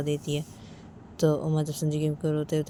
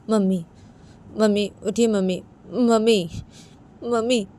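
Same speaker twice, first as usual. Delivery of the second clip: louder, urgent calls of Mummy through tears; tearful calling; 6 semitones higher, 9 dB louder than usual. A narrator's reading. Uma wakes her mother-in-law, calling tearfully. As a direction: wake her, calling out while crying. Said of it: "रोते रोते संजू के को बुला रही थी".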